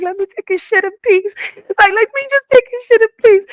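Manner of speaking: high, loud exasperated plea; frazzled mom crying out from the bathroom; pleading for peace. Retold or imitated. Hiding in bathroom, begging for peace.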